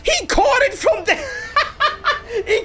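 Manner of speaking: high squeaky giggling through words; can't finish for laughing. Real, not acted.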